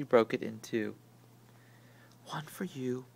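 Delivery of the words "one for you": soft unvoiced whisper; hushed, breathy-free whisper. A narrator's reading. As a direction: whisper it like a secret shared in the dark. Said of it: "she whispered".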